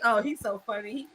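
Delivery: normal talking voice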